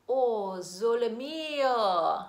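ordinary delivery

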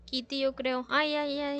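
normal talking voice

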